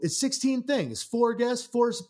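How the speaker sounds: a friend announcing his own podcast, high and loud; high hype friend voice. Retold or imitated. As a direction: squeal it like an excited copycat. Friend voiced in skit retelling.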